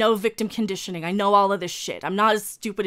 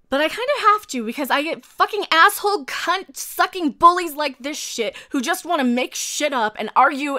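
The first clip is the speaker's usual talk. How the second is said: higher-pitched, heated angry rant; pissed off; 4 semitones higher, 4 dB louder than usual. Real, not acted. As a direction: spit out the insults in real anger. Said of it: "this pisses me off".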